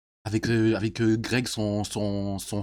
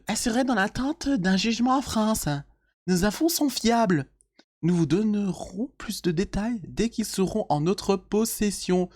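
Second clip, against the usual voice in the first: beauf voice; 7 semitones higher than usual. Acted. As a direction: announce it like a cheesy bro. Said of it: "je vais reprendre ma voix de beauf".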